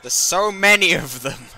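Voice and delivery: loud delighted yell; excited shout. Real, not acted. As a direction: shout with glee at the horde. Delighted at many workers arriving.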